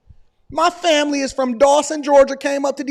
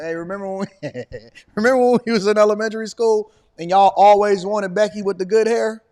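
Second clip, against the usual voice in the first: ordinary talk, nothing marked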